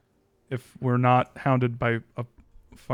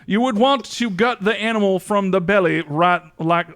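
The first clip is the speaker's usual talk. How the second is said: mock tutorial voice; 4 semitones higher, 6 dB louder than usual. Mock tutorial narrator continuing.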